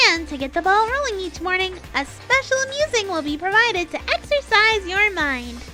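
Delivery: high, loud, hyped announcer voice; fighting game announcer. Acted. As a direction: boom it out like a show host. Announcer voice in story.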